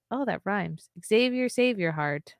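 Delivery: ordinary delivery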